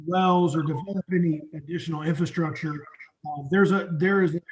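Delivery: normal talking voice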